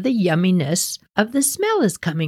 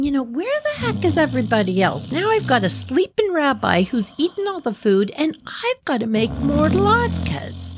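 put-on higher, quick fretting old woman; old lady voice; 5 semitones higher than usual. Old lady fretting about the eaten latkes, higher and quick. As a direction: fuss to herself like a flustered grandma. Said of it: "the little old lady's thinking".

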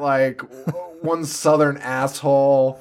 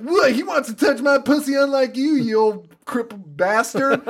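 high, shouted mock rant at an old man; mock screaming girl; 10 semitones higher than usual. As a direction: scream it like a trashy movie teen. Mocking a movie girl's line.

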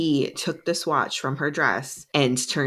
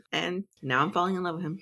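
ordinary talk, nothing marked

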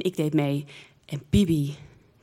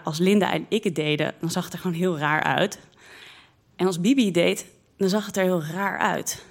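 plain reading voice

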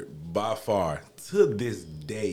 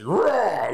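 loud, higher imitation of a roar; mock growl roar; 9 semitones higher, 7 dB louder than usual. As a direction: let out a silly growl. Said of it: "he would just start growling".

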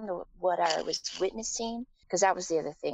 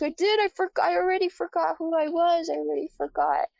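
put-on high, louder, tearful little-kid plea; child crying; 11 semitones higher, 4 dB louder than usual. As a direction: wail it like a remorseful toddler. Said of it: "I start crying".

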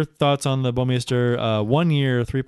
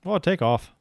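hammed-up Canadian accent, higher; mock Canadian accent; 7 semitones higher than usual. Joking about Canada in a hammed-up Canadian accent. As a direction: brush it off like a Canadian hoser. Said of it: "with that accent".

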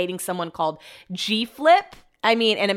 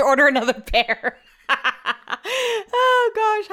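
laughing; 11 semitones higher than usual. Real, not acted. Laughs at losing sunglasses.

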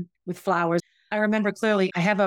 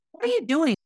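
high-pitched, imitating her own disbelief; re-enacted upset question, pitched well up; retold incredulous protest; 8 semitones higher than usual. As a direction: demand an explanation in a raised pitch. Acted protest to her boss.

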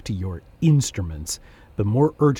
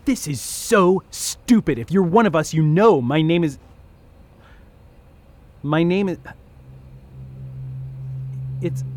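higher-pitched exasperation, stalling at the end; exasperated; 5 semitones higher than usual. Acted. Innovator tries to give own name, exasperated and stalling. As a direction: protest hotly, then get stuck.

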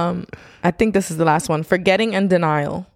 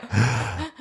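mock gasp; 7 semitones lower, 5 dB quieter than usual. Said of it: "You're like".

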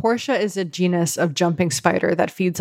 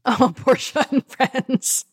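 laughing; 6 semitones higher, slower than usual. Real, not acted. Laughs while repeating the phrase.

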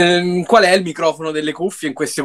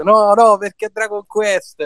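excited protest; 7 semitones higher, 4 dB louder than usual. Retold or imitated. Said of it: "Ho detto".

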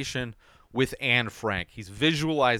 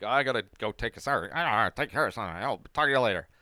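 rushed excuse; much faster than usual. Retold or imitated. Mike hurrying off phone.

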